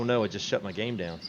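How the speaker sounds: usual speaking voice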